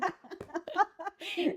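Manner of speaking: high burst of embarrassed laughter; real laugh. Real, not acted. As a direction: laugh at your own craziness. Recuerda abrazar a un desconocido. (risa espontánea)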